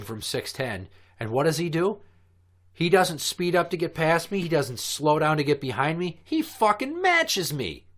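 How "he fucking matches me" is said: exasperated. Real, not acted. Exasperated road rage story.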